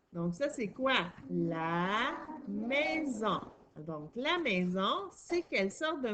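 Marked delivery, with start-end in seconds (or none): 1.3-3.4 very slow, drawn-out syllables; stretched-out beginner reading; slow model reading. Shown as a demonstration. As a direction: read it out syllable by syllable for a learner. Teacher models reading word aloud.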